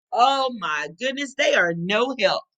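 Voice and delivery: ordinary delivery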